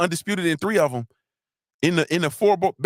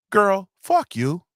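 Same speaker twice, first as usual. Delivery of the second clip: slow, higher-pitched playful cuss-out; lovingly cursing; 5 semitones higher, 3 dB louder, much slower than usual. Real, not acted. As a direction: curse a friend with mock respect, drawn out. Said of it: "respectfully and lovingly".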